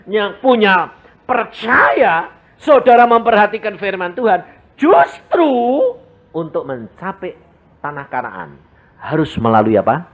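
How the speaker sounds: ordinary delivery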